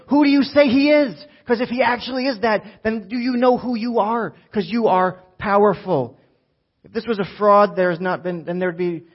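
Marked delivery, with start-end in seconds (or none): 0.1-1.1 loud, high-pitched call to the crowd; fired-up challenge. Real, not acted. Preacher challenging the congregation, loud and high. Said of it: "I'm a little fired up". 1.5-6.2 rising to a loud, higher-pitched peak; fired-up preaching. Real, not acted. Preacher fired up, pushing his church to act, loud. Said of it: "I'm a little fired up".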